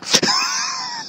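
high shrieking burst of laughter. Real, not acted. Laughing at his own joke.

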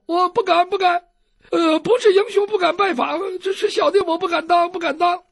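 high, fawning fearful refusal; cringing humility. Acted. Doctor declining the heroes' visit, high fawning voice. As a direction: grovel politely. Captive keeps saying dare not.

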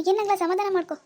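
usual speaking voice

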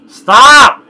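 high, loud shout of stop. Real, not acted. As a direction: yell it out sharply. Friend tickling, yells stop.

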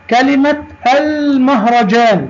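المهرجان with a held, clear l; high-pitched teacher demo sounding the l in المهرجان; moon lam. Shown as a demonstration. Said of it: "لام قمرية". (نطق «المهرجان» بلام ساكنة واضحة)

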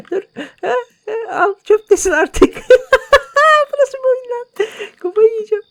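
very high, laughing squeal through the words; helpless laughter. Real, not acted. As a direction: crack up so hard the words squeak out. Cracking up at absurd game.

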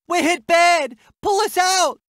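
high-pitched plea for rescue; acted battlefield alarm; urgent distress call. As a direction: call for evacuation under fire. Hit, calling for rescue.

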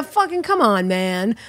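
ordinary talk

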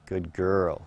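hushed praise to the horse; soft praise. Real, not acted. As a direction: murmur it.

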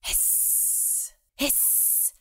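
breathy whispered hiss; bat hiss. Acted. Bat hisses at the knights from the dark.

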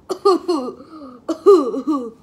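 real burst of laughter; loud laugh. Real, not acted. After swallowing shaving cream.

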